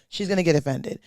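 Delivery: ordinary delivery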